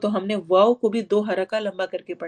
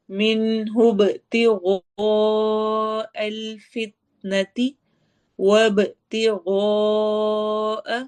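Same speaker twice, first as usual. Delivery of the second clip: very slow chanted recitation, heavy غ; Quran recitation; much slower than usual. Shown as a demonstration. Tajweed teacher reciting the verse for the class. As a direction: chant it slowly with a full heavy ghain. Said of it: "غین موٹے حروف میں سے".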